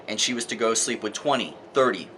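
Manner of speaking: ordinary delivery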